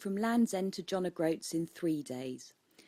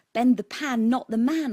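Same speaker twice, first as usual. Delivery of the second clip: louder, punchy proud slogan; eureka slogan; 3 semitones higher, 7 dB louder than usual. Retold or imitated. Inventor proudly voicing his cookware slogan, louder and punchy. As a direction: announce the idea like a eureka moment. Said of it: "I said to myself".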